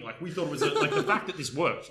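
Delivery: laughing while telling it. Real, not acted. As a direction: laugh through the words. Laughing about blowing up ship.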